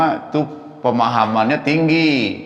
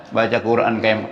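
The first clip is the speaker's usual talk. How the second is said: ordinary talk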